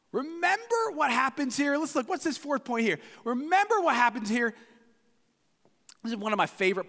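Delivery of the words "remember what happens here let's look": normal talking voice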